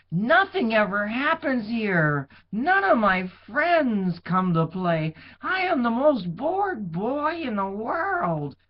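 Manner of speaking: put-on high, slow, whiny bored boy; bored shepherd boy. A narrator's reading. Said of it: "thought the shepherd".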